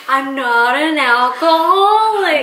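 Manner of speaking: put-on slow, high, loud drunk denial; Meredith impression. Wife quoting Meredith's slow tipsy denial. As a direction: drag out alcoholic like a tipsy coworker. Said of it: "The way she says it".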